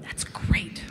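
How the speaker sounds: wry whisper under the breath. Real, not acted. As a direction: whisper it sarcastically.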